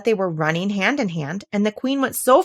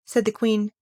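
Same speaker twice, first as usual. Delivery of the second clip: ordinary delivery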